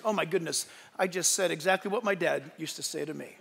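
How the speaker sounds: low, soft appalled aside; dismayed realization. Retold or imitated. Man catching himself sounding like his dad, low quiet dismay.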